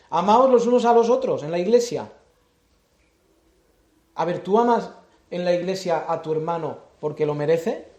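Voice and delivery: loud, driving sermon voice; full-force preaching. Acted. Preacher urging the congregation on love, at full force. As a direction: preach it out to the whole church.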